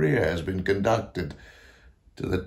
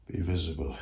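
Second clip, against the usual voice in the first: lower, softer entreaty; hushed plea; 4 semitones lower, 8 dB quieter, faster than usual. Acted. Composer begs ghosts to appear, hushed plea. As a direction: let the summons sink to a soft, low request.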